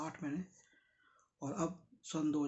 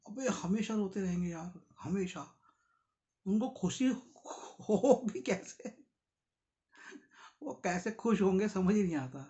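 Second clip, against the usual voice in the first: raised-pitch imitation of a moaner; mock complaining; 4 semitones higher, 5 dB louder than usual. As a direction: act out someone forever lamenting, a bit louder. Voices habitual moaners, quarrel-like lament. (शिकायती रोने वालों की नकल)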